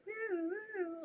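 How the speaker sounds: high woo-woo siren sound; mock siren. Imitating a siren that warns about eating ice cream. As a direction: imitate a little alarm siren.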